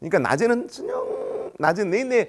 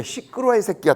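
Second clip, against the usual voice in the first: higher, snappish mock telling-off; angry parent; 8 semitones higher, 3 dB louder than usual. Irate parent silencing official on phone, angry. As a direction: cut them off with an acted angry yell.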